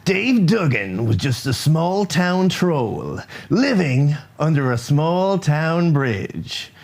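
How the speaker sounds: slow, dramatic trailer narration; movie trailer voice. Acted. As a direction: stretch each word like an epic trailer. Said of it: "Movie, movie trailer voice".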